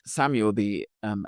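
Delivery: ordinary talk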